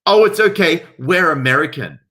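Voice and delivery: a high, loud mock of a pushy mother; shrill parody of an entitled mother yelling back. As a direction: toss it off like a smug tourist mum. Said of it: "mother yelled back".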